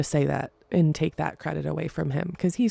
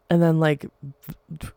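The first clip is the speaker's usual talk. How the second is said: normal talking voice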